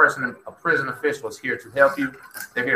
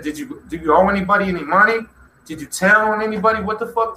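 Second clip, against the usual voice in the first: higher, urgent demanding questions; alarmed questioning; 8 semitones higher than usual. Retold or imitated. Fellow inmate grilling Tim about debts, higher and alarmed. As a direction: press him hard for answers.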